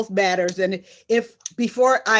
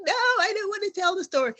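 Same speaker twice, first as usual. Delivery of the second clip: put-on high, fast, childlike protest; imitating herself balking at her mentor, high and quick; whiny refusal; 11 semitones higher, faster than usual. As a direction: refuse like a reluctant kid, pitched up. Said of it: "And I'm like".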